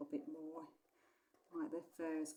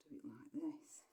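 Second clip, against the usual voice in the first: soft unvoiced whisper; 11 dB quieter than usual. Real, not acted. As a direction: whisper it to yourself. Aside while drawing.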